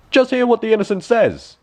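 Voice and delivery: high, loud marvelling father; father amazed at his child; voiced father. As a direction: call attention to the child's words. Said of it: "said the father".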